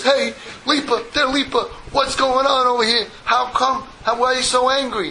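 high-pitched, acted bewildered appeal; calling after someone. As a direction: call out, puzzled, as he storms off. Leib runs out calling after Lipa.

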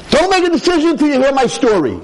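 high, loud, pleading rabbi voice; voicing the rabbi. Rav Simcha's urgent words.

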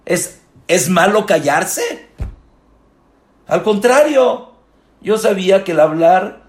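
high, loud pulpit cry; fervent preaching. Acted. Rabbi urging his congregation, loud fervent preaching. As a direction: proclaim it to the whole hall.

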